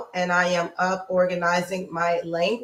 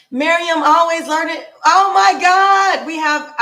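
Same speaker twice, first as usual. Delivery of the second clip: thrilled greeting; 8 semitones higher, 8 dB louder than usual. Real, not acted. Host spotting a favorite viewer in chat, thrilled. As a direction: squeal with joy at a friend arriving.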